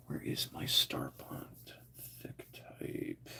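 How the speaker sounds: slow whisper to yourself. Real, not acted. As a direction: whisper while searching. Whispered search.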